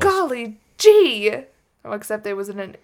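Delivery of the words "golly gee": high, slow, loud and prim; prim exclamation. Pretend. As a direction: gasp it like a scandalized lady. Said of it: "expletives like".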